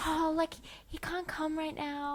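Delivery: mock-innocent secretary brushing off a caller; coy secretary. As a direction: coo it like a prim secretary covering for him. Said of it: "she's like".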